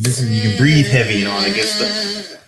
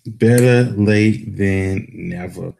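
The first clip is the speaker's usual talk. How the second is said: slow drawn out; much slower than usual. Acted. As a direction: stretch every word out slowly. Echoes catchphrase, drawn out.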